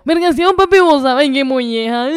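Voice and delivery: high, slow hammed-up Maracaibo drawl; exaggerated Maracucho accent. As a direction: overdo the Zulia accent. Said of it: "la gente exagera a veces los acentos".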